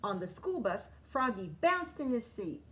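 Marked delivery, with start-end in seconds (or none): none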